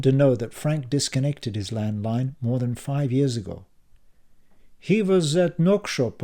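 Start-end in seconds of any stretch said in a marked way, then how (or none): 4.8-6.2 put-on accented older man voice. A narrator's reading. Narkis voiced in story.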